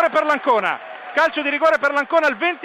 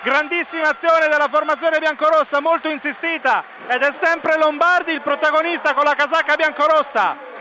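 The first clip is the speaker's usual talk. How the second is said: breathless italian goal commentary; 7 semitones higher, 4 dB louder than usual. Real, not acted. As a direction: scream the goal and keep it up. Second goal called live. (urlo del gol, telecronaca senza fiato)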